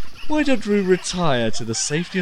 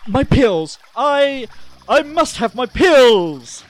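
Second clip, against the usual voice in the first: desperate plea; 7 semitones higher, 5 dB louder, slower than usual. Acted. Panic attack, begging for pills.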